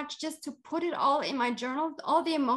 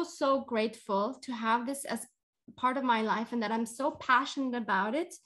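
usual speaking voice

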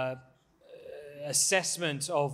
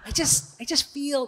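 mocking American accent, higher; bad American accent; 10 semitones higher than usual. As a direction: mimic the earnest American again, pitched up. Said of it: "my really bad American accent".

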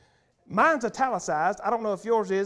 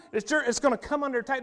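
normal talking voice

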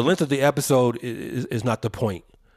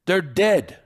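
ordinary talk, nothing marked

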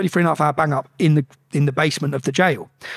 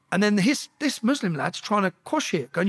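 ordinary talk, nothing marked

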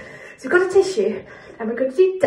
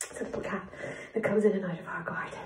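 soft breathy aside; hushed whisper about the cat; 7 semitones lower, 13 dB quieter, faster than usual. Real, not acted. Quiet aside about garden cat.

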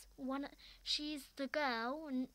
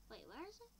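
ordinary delivery

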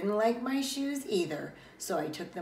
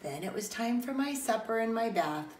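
usual speaking voice

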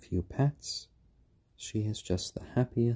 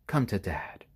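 ordinary talk, nothing marked

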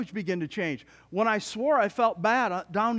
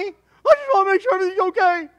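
high, loud, tearful mock mother; worried mom; 12 semitones higher, 9 dB louder than usual. Preacher voicing his mom's worried plea, high and loud. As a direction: whimper it high like a fretting mom. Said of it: "you're worried about me?".

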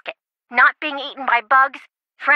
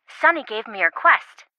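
ordinary delivery

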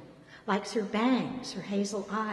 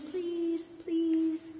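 high pleading please; wheedling; 9 semitones higher than usual. A narrator's reading. Pat begs the clerk to let them in, wheedling. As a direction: beg sweetly, drawing it out. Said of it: "she wheedles".

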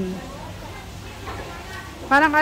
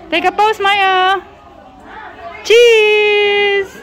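cheery photo call; 5 semitones higher, much slower than usual. Real, not acted. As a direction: call out cheese brightly.

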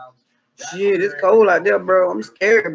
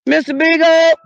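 calling out; 6 semitones higher, 5 dB louder than usual. Acted. Owner calling her lost dog through the woods, loud call. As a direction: call the dog's name loud and high.

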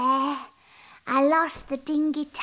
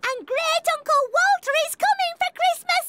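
excited; 12 semitones higher, faster than usual. Acted. Princess tells of uncle visiting.

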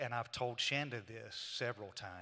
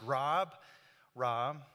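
mildly raised voice, calling a name; 6 dB louder than usual. Retold or imitated. Man calls to his sleeping brother in the dark bedroom, calling out. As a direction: call to rouse someone sleeping.

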